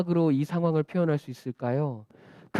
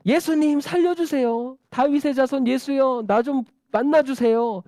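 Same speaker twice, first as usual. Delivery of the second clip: earnest crying out; 7 semitones higher, 4 dB louder than usual. Acted. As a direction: beg desperately with raised pitch. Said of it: "그 간절한 마음으로 계속 소리를 지르는".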